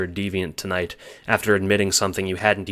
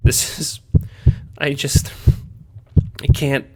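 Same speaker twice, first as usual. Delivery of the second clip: tearful; 7 semitones higher, 5 dB louder than usual. A narrator's reading. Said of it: "you say through silent tears".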